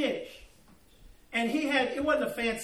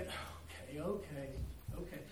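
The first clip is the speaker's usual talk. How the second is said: soft unvoiced reluctant okays; grudging okays; 6 semitones lower, 13 dB quieter than usual. Fisherman to himself after the warning, grudging mutter. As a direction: mutter it under your breath. Giving in, thinking.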